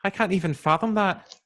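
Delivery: high, lilting put-on Irish accent; mock-indignant Irish accent. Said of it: "That's a really good accent".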